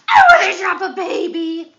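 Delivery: loud mock protest; playful shout. Playful back and forth with baby.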